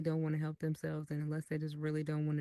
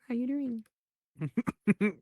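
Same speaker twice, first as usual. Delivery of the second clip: very drawn-out, higher playful greeting; slow, exaggerated singsong hello; signature catchphrase greeting; 5 semitones higher, much slower than usual. Shown as a demonstration. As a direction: stretch the greeting out like a running joke. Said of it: "Why you say it like".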